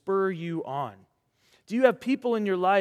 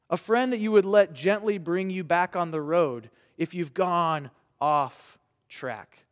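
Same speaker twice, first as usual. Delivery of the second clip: ordinary talk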